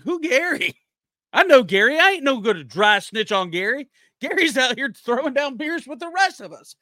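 high, loyal buddy refusing to snitch; defensive buddy. Retold or imitated. Covering for buddy Gary.

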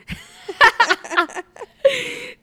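shrieking laugh; high loud laugh. Real, not acted. Laughing at her own handwriting, high shriek. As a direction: let out a high cackle.

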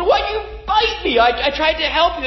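high, hurt protest; wounded man's cry. Retold or imitated. Bitten man crying out at the snake, high and hurt. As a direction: cry out betrayed by the one you saved. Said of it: "And he said".

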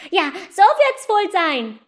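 put-on high, slow small boy agreeing; a small brother drawing it out; little boy. Matz agrees with his sister, high and slow. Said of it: "sagte Klein Matz".

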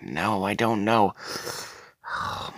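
ordinary talk, nothing marked

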